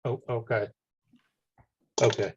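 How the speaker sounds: ordinary delivery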